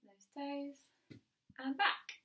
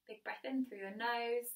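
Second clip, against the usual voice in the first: soothing fitness cool-down cue; calm cool-down guidance; 4 dB quieter than usual. Acted. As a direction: ease the class into rest. Instructor leads a relaxing cool down.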